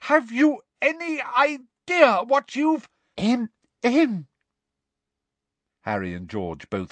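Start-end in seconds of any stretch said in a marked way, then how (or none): 0.0-2.9 high, slow, outraged demand; bellowed. A narrator's reading. Professor mid-tirade before interruption, bellowed. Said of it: "bellowed Professor McGonagall". 3.2-4.3 high, dainty interrupting cough; affected throat-clearing. A narrator's reading. Woman in doorway interrupting a tirade, dainty affected ahem.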